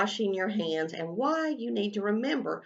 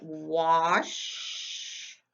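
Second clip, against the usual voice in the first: stretched dictation; 3 semitones lower than usual. Shown as a demonstration. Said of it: "I'm gonna write".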